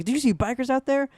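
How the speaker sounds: high, loud urgent question; retold alarm. Boy asks his sister arriving at the door, retold alarm.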